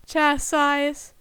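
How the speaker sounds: ordinary delivery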